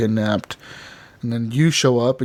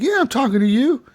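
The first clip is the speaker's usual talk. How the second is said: mock challenge; 10 semitones higher than usual. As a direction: imitate someone squaring up in a bar. Acted bar quarrel.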